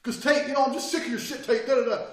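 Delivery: loud, high mock tirade; argumentative ranting. Attacker trying to restart the argument, ranting. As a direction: imitate a fed-up man yelling. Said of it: "He's trying to get me to argue again".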